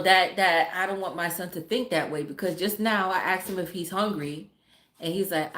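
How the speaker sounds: ordinary delivery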